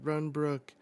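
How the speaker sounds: acted urgent shout; urgent cry. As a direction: call out a warning from a memory. Flashback cry urging him to flee.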